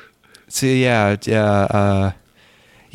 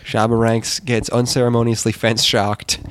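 ordinary talk, nothing marked

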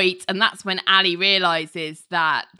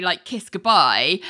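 ordinary delivery

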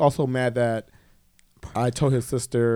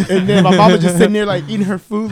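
laughing; 6 semitones higher, 10 dB louder, faster than usual. Real, not acted. Laughing while telling story.

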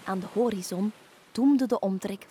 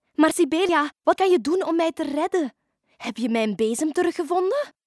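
higher, louder, eager questioning; anxious witch; 6 semitones higher, 6 dB louder than usual. Acted. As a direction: plead for answers as a captive witch. (bezorgde, vragende heks)

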